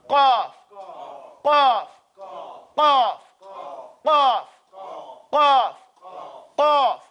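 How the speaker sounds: "Cough" with q popping at the back of the mouth; popped qaf. Shown as a demonstration. Teacher drills students on the back pop, popped "Cough". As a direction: punch out each "Cough" from the throat. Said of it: "It pops in the back".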